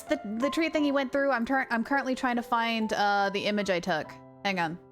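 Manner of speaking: usual speaking voice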